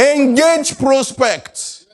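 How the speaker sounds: slow, high, drawn-out stress on both words; emphatic teaching. Shown as a demonstration. Teacher pressing a key phrase on students, slow and emphatic. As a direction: stretch "Engage prospects" out so it sticks. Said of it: "teacher drills key phrase".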